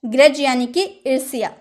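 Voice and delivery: ordinary talk, nothing marked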